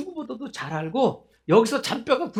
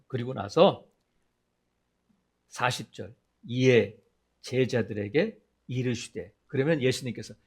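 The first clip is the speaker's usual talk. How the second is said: usual speaking voice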